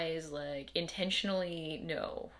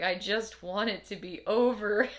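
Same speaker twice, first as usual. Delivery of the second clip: retold tired plea; 4 semitones higher, 8 dB louder than usual. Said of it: "and it's like".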